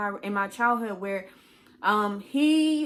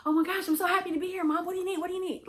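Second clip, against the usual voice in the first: high, fast sugary eagerness, mocked; mocking fake eagerness; 10 semitones higher, much faster than usual. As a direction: parody a too-eager kid fawning over mom.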